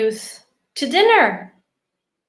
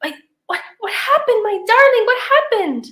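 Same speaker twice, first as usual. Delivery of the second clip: concerned; 8 semitones higher, 4 dB louder than usual. Acted. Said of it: "all concerned".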